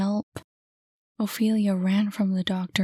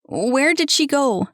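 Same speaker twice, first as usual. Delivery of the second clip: loud, high, demanding; raised voice; 6 semitones higher, 7 dB louder than usual. Acted. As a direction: shout it at his mother. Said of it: "He raised his voice".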